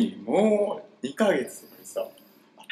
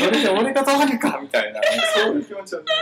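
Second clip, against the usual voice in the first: laughing; 8 semitones higher, 5 dB louder than usual. Real, not acted. Laughing while denying feelings.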